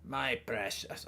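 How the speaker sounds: low, slow creature attempt; botched creature impersonation, slow and low; Gollum. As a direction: drag it out in a half-formed creature voice. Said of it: "I can't do it".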